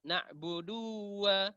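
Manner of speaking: "Na'budu wa" as "na'buduww-wa"; wrongly doubled waw. Shown as a demonstration. Teacher shows added letter error, doubled waw. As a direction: hold the du, then double the w of "wa".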